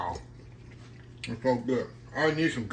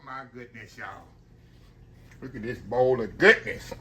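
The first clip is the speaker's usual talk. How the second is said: usual speaking voice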